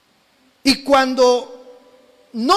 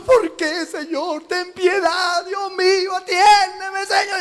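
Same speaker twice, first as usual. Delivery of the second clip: mock whining plea; 5 semitones higher, slower than usual.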